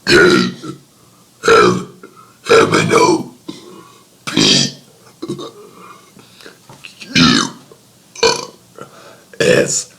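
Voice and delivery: letters burped one by one; burping the words. Pretend. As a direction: push each letter out on a burp. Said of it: "Gonna be burping the A B Cs".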